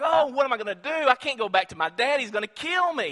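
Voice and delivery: high, loud panic; panicked worry. Acted. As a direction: sound frantic about facing your father. Reuben panics about father.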